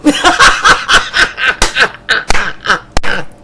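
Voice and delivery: loud laugh; laughing hard at the joke; hearty laugh. Real, not acted. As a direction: burst out laughing.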